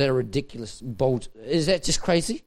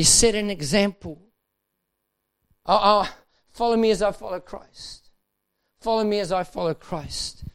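ordinary talk, nothing marked

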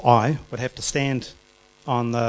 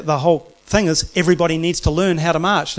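ordinary delivery

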